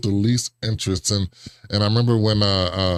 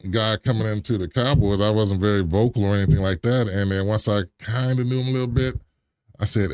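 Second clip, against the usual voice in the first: usual speaking voice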